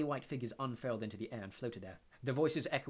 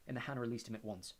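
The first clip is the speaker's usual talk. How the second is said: plain reading voice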